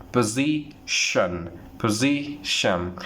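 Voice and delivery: position very slowly, po-ZI-tion; teacher drills position slowly; slow model. Shown as a demonstration. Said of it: "correct pronunciation".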